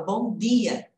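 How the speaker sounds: "bom dia" with a pure d, "dee-a"; hard dental D. Retold or imitated. Teacher imitating other regions' speakers, hard D in "dia". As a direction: say "dia" with no "dj" sound. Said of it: "Tem gente que fala".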